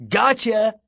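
loud, high-pitched gotcha; triumphant yell. A narrator's reading. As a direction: yell it like you caught them. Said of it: "he yelled".

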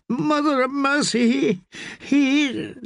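high voice breaking into sobs; sobbing. Acted. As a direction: choke on the words as she breaks down. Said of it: "she broke down sobbing".

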